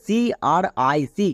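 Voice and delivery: slow spelling of সিআরআইসি, letter by letter. Shown as a demonstration. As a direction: spell C-R-I-C slowly. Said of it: "ক্রিক".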